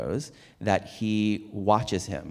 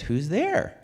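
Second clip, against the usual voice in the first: frightened; 9 semitones higher than usual. A narrator's reading. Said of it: "asks a frightened voice inside".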